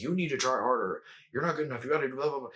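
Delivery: strict, nagging inner critic; lightly acted scolding, running on into blah-blah; stern shame voice. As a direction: lay down the law like an inner critic. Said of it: "It's stern, it's strict".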